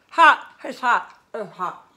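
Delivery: very loud first cry, then easing off; burnt-mouth yelp. Real, not acted. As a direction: yelp it round a scalding bite. Mouth burnt by hot mushroom.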